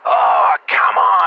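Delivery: high, loud outraged cry; loud high exasperated "come on"; exasperated shout. Acted. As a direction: protest in disbelief. Exasperated protest.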